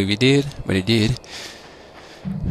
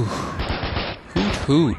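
owl hoot; 7 semitones higher than usual. Pretend. Owl-like hooting call.

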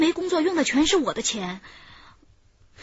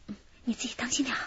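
quick hushed whisper; 6 dB quieter, faster than usual. Acted. Woman whispers to accomplice slipping out the window, hushed and quick. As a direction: whisper fast so nobody outside hears. Hiding from police at door.

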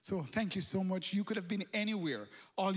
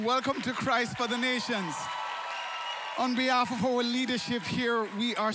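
loud, higher rallying welcome shout; big crowd welcome; 3 semitones higher, 5 dB louder than usual. Real, not acted. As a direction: call it out warmly to the whole room.

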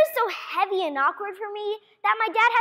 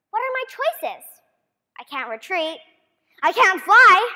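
ordinary talk

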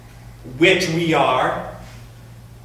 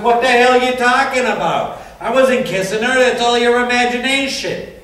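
higher, louder indignant denial; retold angry shout; 8 semitones higher, 8 dB louder than usual. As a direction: bark the denial back at her. Retold quarrel with his wife.

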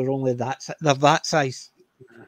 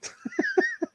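high laugh; 4 dB quieter than usual. Real, not acted. Laughing at Chiggy von Beethoven.

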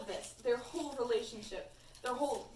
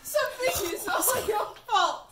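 put-on Crafty Girls voice; 12 semitones higher, 13 dB louder than usual. Cleo's note to Lewis, high hammed-up wailing. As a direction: wail it hammed-up, laughing through it. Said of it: "how do you speak in Crafty Girls?".

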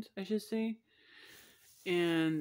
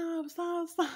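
much higher mock cries of stop; mock scream; 12 semitones higher, slower than usual. The girl begging the masseur to stop, mock high yelp. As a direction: imitate a girl yelping mid-massage, pitched way up. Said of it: "she started screaming and told him to stop".

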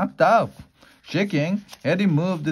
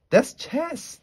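high-pitched, snappy comeback; snapped retort; 12 semitones higher than usual. A narrator's reading. Ron answering his friends' protest, snapped. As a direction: snap back with the pitch jumping up. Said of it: "snapped Ron".

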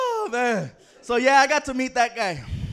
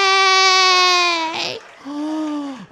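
loud gasp; 5 semitones higher, 4 dB louder than usual. Acted. Overcome by the word.

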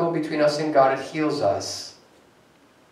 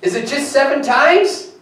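higher, louder, eager questioning Peter; Peter asking Jesus; 11 semitones higher, 7 dB louder than usual. Acted. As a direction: push the question up like a puzzled disciple. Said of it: "and he says to Jesus".

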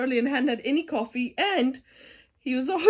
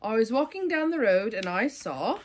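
ordinary delivery, nothing marked

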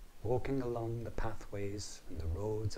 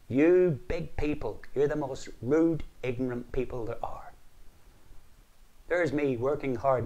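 higher, louder indignant rant; indignant scolding; 3 semitones higher, 6 dB louder than usual. Acted. Caught leprechaun scolds Patrick, higher and louder. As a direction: tell the big man off.

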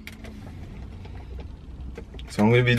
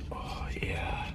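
breathy pleased whisper; 4 semitones lower, 17 dB quieter than usual. Real, not acted. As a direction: whisper it to yourself. Pleased aside after a sip.

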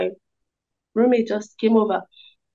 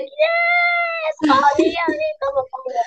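excited scream; slower than usual. Retold or imitated. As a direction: scream it out in delight. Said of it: "come and see how she screamed".

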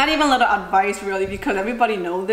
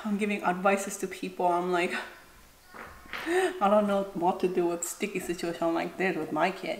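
quiet; 8 dB quieter than usual. Real, not acted. Talking quietly near the angry child.

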